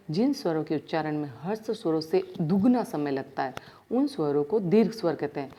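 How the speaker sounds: ordinary delivery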